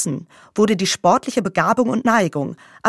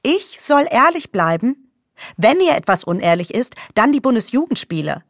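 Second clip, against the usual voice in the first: getting worked up; 4 semitones higher than usual. Acted. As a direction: bristle with outrage. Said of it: "jetzt regt sie sich auf".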